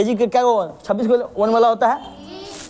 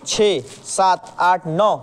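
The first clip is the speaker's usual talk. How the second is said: slow counting; 4 semitones lower, much slower than usual. Shown as a demonstration. Counting slowly for pupils.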